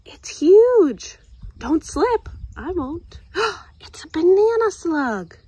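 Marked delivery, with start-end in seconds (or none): 0.1-1.2 high, breathy amazement; awed. Acted. Girl amazed at the giant tree, high and breathy. 3.4-5.4 high, breathy gasp of discovery; surprised gasp. Acted. Girl discovering a banana slug, high breathy gasp.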